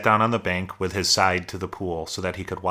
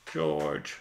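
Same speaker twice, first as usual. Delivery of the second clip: whispered, fading call; faint cry; 7 dB quieter than usual. A narrator's reading. Lenny's last call for George, a faint whispered cry. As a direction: let the name sink to a whisper. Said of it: "And he cried".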